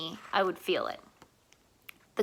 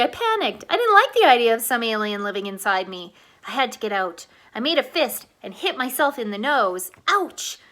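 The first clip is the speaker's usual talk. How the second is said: ordinary delivery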